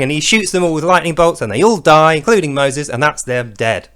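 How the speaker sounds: normal talking voice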